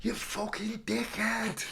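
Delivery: high, drawn-out mock heckle; heckler. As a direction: jeer it slowly in a high put-on voice. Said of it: "The heckler, they give him".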